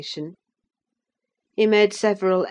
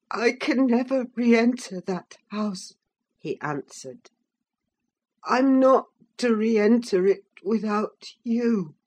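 put-on higher, timid boy; frightened boy voice; 4 semitones higher than usual. A narrator's reading. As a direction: plead weakly. Linton voiced by narrator.

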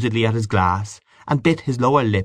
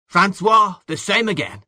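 calling out; 10 semitones higher than usual. Acted. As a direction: hail the waiter for another round. Calling out to the waiter.

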